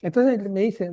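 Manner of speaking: ordinary talk, nothing marked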